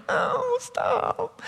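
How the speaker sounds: high, soft little wail; mock protest. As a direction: squeak it out feebly and drawn out. Kid reacting to brother's hit.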